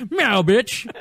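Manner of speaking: loud defiant meow; sassy cat. Pretend. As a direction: spit it back like a cheeky cat. Cat snaps back.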